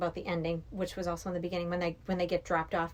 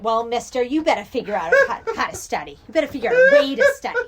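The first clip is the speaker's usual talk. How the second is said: loud scolding mom voice; hammed-up scolding parent, louder and higher; stern mother; 5 semitones higher, 13 dB louder, faster than usual. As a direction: lay down the law like a strict mother. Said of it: "She's like, one line".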